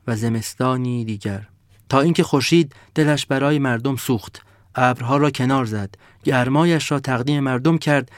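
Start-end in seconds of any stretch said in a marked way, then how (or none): none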